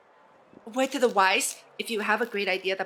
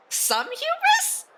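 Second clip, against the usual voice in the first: ongelovig; 4 dB louder, much slower than usual. Pretend. Mock disbelief at game text.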